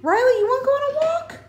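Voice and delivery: very high sing-song dog talk. Real, not acted. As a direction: coo it to the puppy.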